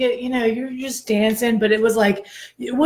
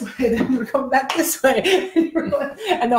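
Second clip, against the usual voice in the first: talking through laughter, higher; laughing; 5 semitones higher than usual. Real, not acted. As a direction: laugh as you describe it. Laughing while describing class.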